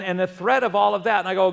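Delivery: ordinary talk, nothing marked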